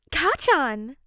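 high, loud excited call; excited squeal. A narrator's reading. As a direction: call out the name in delight. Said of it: "Ashido said excitedly".

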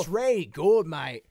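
brief loud mock Australian; Australian accent. Bandmate echoing the offer in mock Australian accent. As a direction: chime in with a bad Australian accent. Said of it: "I can't do Australian".

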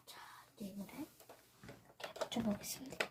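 soft breathy whisper while working. Real, not acted. Crafter whispering to viewers while gluing. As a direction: hush it like you're concentrating.

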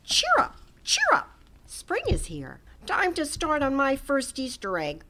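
high, bright and bouncy little rabbit; cheerful bunny voice. A narrator's reading. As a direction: chirp it out like an eager young bunny. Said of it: "Hoppy said".

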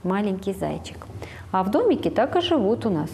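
usual speaking voice

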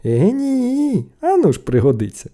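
high start, then settling; stubborn little brother; boyish refusal. A narrator's reading. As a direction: cheerfully dig your heels in. Boy refuses his brothers.

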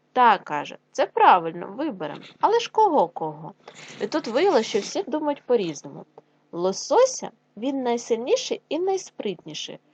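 put-on loud, lively storytelling for children; animated read-aloud of a folk tale; fairy tale reading. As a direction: read it out big for second graders.

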